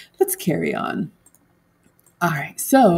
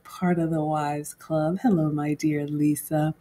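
normal talking voice

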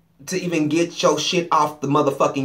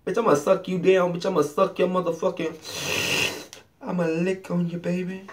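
mock seductive come-on; 3 semitones higher, 4 dB quieter than usual. Acting out the lover's come-on.

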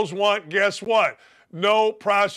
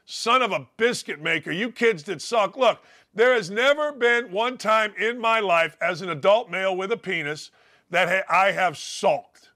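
ordinary talk, nothing marked

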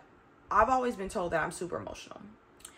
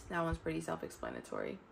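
much softer, muttered repeat; quiet aside; 8 dB quieter than usual. Real, not acted. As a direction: say it again under your breath to yourself.

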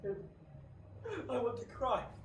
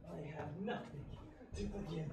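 hollow murmur; 6 semitones lower, 10 dB quieter than usual. Acted. Faustus sinks low.

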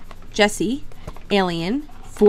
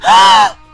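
delighted gasp; 8 dB louder than usual. Real, not acted. Sees Bonnie on figure.

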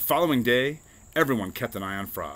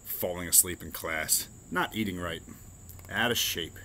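ordinary delivery

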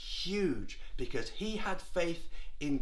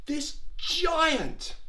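high-pitched, worried wail; King Saul; 9 semitones higher than usual. Acted. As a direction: play a king at his wits' end. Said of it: "And the king, King Saul, said".

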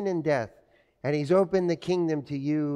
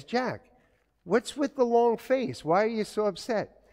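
higher, lightly voiced concerned mother; caring mom; 6 semitones higher than usual. Mom asks sulking teenage son, lightly voiced concern. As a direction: ask gently like a worried mom. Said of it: "My mom says".

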